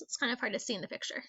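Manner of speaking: ordinary talk, nothing marked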